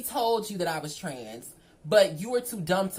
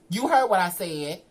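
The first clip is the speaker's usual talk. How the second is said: firm retort; 8 dB louder than usual. Retold or imitated. Snapping back at him.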